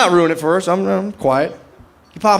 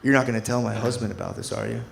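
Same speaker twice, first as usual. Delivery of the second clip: lower, softer seductive wife; hint of a low seductive wife voice; role play wife; 6 semitones lower, 7 dB quieter than usual. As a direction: purr it low like a cheating wife. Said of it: "She goes".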